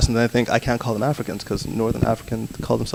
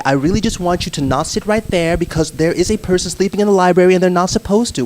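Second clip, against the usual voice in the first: higher, entitled complaining Karen; 6 semitones higher than usual. Shown as a demonstration. Demonstrating a Karen to the group, higher and entitled. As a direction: whine it like a Karen at the library. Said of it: "we all know it's a Karen".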